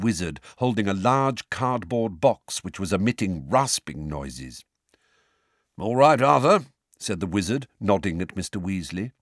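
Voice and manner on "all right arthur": hearty greeting. A narrator's reading. Big wizard greets Mr Weasley.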